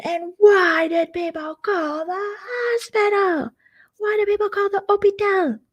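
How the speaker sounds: very high, drawn-out wail; hammed-up wailing outrage; mock yelling. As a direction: imitate a screeching demand, stretched and high. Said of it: "they would just yell at each other".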